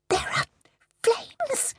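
high, piercing cry of terror; shrill cry. Acted. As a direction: cry out as flames appear. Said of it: "The medium's voice was shrill".

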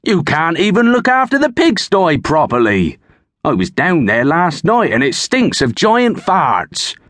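bossy farmer ranting about the pigsty; angry shouting farmer. Acted. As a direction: tear a strip off the lazy servant. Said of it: "Thorgil shouted".

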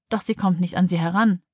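plain reading voice

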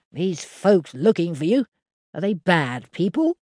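a simple countryman telling what happened; lightly voiced old farmer; Henry the farmer. A narrator's reading. The farmer asks.

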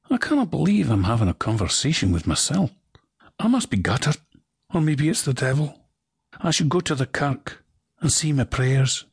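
a bewildered Scot talking to himself; Scottish accent. Acted. A man talks to himself in broad Scottish.

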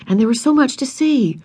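usual speaking voice

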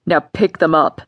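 a cruel father giving orders; harsh order. Acted. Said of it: "he ordered harshly".

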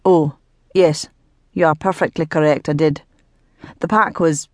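a low, grudging admission in character; gruff Earl, conceding. A narrator's reading. Earl admitting his mistake to the ghost, grudgingly. Earl talking while washing.

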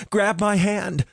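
high, urgent call; urgent shout. Acted. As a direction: reach out and yell to him. Reaching for drowning friend.

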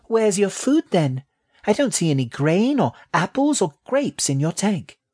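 very high little rat voice; high-pitched young creature demanding answers; questioning baby rat. A narrator's reading. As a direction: challenge as a small, curious rat.

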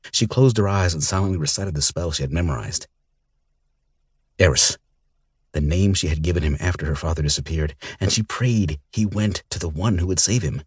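ordinary delivery

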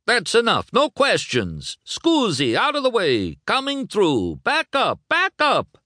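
high, agitated orders, climbing at the end; shouting crowd control. Acted. Luigi clearing reporters away from Lightning, agitated shouting. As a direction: shoo the crowd back in bursts. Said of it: "shouted Luigi".

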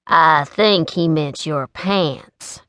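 slow, lazy drawl; a slow, amused drawl. Acted. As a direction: stretch it out slowly, unbothered. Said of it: "Colt drawled".

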